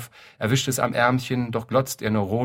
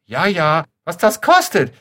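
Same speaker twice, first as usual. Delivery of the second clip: much higher, louder, slow shriek; shrill old lady crying out; 8 semitones higher, 10 dB louder than usual. Acted. Old pensioner on the street, shrieking shrill. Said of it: "gellt noch einmal".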